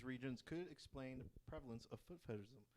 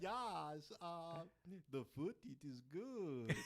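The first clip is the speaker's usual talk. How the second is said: slow, hammed-up Italian accent; mock Italian accent; 3 semitones higher, much slower than usual. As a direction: drawl it like a cartoon Italian. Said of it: "it's Italian".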